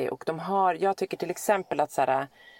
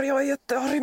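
imitating son; 7 semitones higher than usual. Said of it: "pratar han med mig så här".

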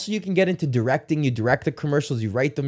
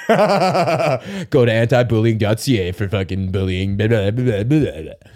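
mock announcer tag; 4 semitones lower, slower than usual. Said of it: "some serious announcer".

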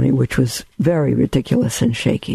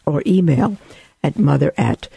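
ordinary delivery

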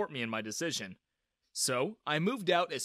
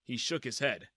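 ordinary delivery

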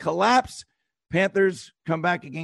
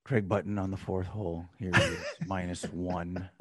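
hushed, much lower golf announcer voice; golf commentator; 13 semitones lower, 11 dB quieter than usual. Pretend. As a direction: murmur it softly like a Masters broadcaster. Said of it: "that's my golf voice".

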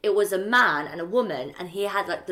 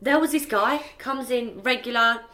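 ordinary talk, nothing marked

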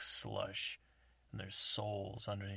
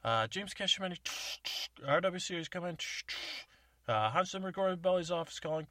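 over CB radio; 9 semitones higher, 6 dB louder than usual. Acted. CB radio call continues.

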